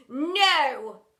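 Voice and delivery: sharp loud no. Acted. Headmistress refusing again, loud. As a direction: shut it down.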